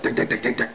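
soft unvoiced tick tick knocking sound; knees rattling. Mimicking his own knees knocking from fear, soft clicks. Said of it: "my knees are going, rattling".

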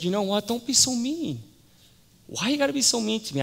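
high-pitched, wounded pleading; hurt protest. Retold or imitated. Woman answers her scornful neighbour, hurt and high-pitched.

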